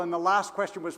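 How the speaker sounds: ordinary delivery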